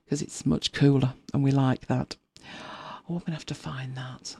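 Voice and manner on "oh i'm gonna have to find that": half-whispered to herself; whispered aside. Real, not acted. Host whispering to herself while searching online, hushed. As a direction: whisper it under your breath as you search.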